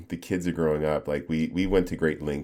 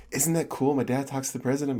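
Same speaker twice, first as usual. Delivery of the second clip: put-on higher-pitched proud kid; child's eager voice, pitched up; imitating excited kids; 8 semitones higher than usual. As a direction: brag like an impressed child. Voices proud kids telling him.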